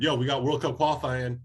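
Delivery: ordinary talk, nothing marked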